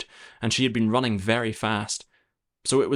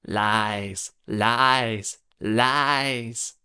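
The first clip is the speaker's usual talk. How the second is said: hoarse goblin voice; much slower than usual. A narrator's reading. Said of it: "hoarse, indistinct voice".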